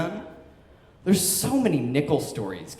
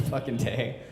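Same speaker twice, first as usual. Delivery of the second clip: ordinary talk, nothing marked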